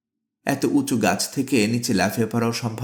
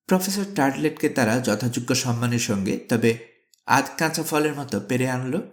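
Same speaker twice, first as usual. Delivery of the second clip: usual speaking voice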